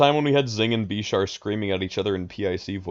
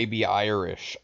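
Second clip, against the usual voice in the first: put-on pirate accent; 4 dB quieter than usual. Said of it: "that sounds like a pirate".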